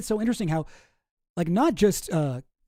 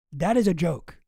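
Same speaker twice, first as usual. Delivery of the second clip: ordinary delivery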